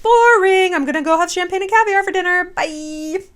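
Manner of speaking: high, sing-song brush-off; breezy mock dismissal. Acts out her dismissive younger self.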